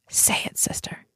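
hushed, whispery encouragement; whispered reply. Retold or imitated. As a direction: whisper it back to her like a secret invitation. Retold exercise, woman answering softly.

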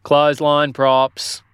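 slow drawn-out mild street cry; lightly voiced pole seller shouting; vendor's shout. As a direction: call the wares lazily. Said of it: "as his shout soon told them".

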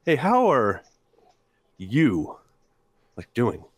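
drawn-out, prying check-in with heavy pauses; slow, probing delivery. Shown as a demonstration. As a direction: stretch it out so it sounds invasive. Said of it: "slow down the question, right, and deliver it like that".

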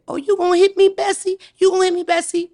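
high taunting voice; mocking taunt. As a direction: mimic the neighbor daring grandma, pitched high. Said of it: "The lady say".

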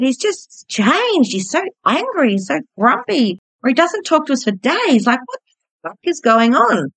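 put-on high-pitched, heated replay of the teenage rant; retold furious outburst. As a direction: relive the rage without the volume. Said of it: "shaking with anger".

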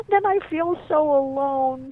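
put-on slow, high, loud child lament; forlorn little boy. Little boy confides in his mom after tug of war, forlorn. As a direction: wail it slowly like a small kid.

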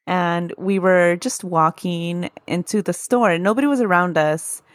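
ordinary delivery

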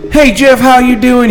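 loud, high Southern drawl greeting; southern accent. Retold or imitated. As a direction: ham up a Southern good ol' boy. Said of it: "He defined, like, the southern accent".

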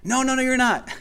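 bright, high quick protest; a jump up in pitch to protest; high pitched denial. Acted. Protesting high to the rival voice. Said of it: "The rival voice says".

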